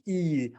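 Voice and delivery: E said with spread lips as in say cheese; teacher showing the smile for E; smiling E. Shown as a demonstration. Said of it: "are you smiling?".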